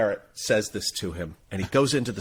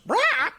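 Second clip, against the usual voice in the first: very high parrot screech; parrot squawk; 3 dB louder than usual. Acted. Parrot to a passing guy, squawking. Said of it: "and the parrot goes".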